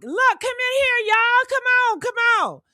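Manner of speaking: high urgent calling; calling people over. Pretend. Calling neighbours into the room, high urgent call. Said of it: "I'd use my big mouth".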